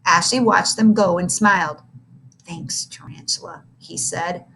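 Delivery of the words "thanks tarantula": whispered low thanks; a hushed grateful thanks; quiet thanks. A narrator's reading. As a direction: breathe a quiet thank-you. Grateful boy thanks bully quietly.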